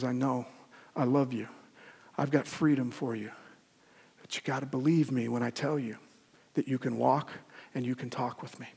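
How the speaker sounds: gentle God. Acted. Voicing God tenderly.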